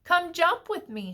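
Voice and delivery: high, lively frog call. A narrator's reading. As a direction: call a friend over to play. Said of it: "she called".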